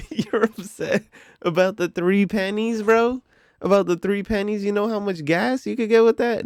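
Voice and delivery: high, incredulous retort through a laugh; laughing disbelief. Retold or imitated. Cashier laughs at the petty complaint.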